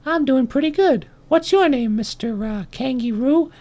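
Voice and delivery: very high ant voice; little ant. Acted. Ant meeting the kangaroo, high little voice. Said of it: "And he says".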